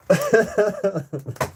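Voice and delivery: loud high laughing fit; high burst of laughter. Real, not acted. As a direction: explode into laughter. Cracking up.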